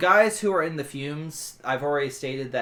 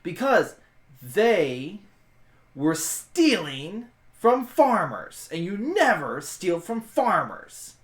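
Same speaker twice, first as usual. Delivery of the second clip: high, loud, slow and emphatic; indignant, drawn-out declaration with big pitch swoops; emphatic indignation; 5 semitones higher, 5 dB louder, slower than usual. Acted. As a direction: lay down the law, word by word. Acted Raz lays down her code.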